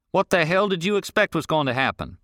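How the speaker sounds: gruff rancher. Acted. Rancher questions the boy on the road, irritated. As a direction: demand an answer, irritated.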